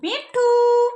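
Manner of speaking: loud, high, held-out name; coaxing a parrot. Shown as a demonstration. Models name for parrot to repeat. (तोते के लिए ऊँची, ज़ोरदार पुकार)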